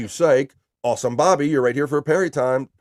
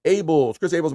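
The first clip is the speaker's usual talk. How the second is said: ordinary talk, nothing marked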